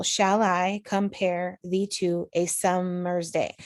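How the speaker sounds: slow two-syllable groups, "summer's" split in two; broken into iambs. Shown as a demonstration. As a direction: measure out "Shall I compare" foot by foot, slowly. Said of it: "right here is my example".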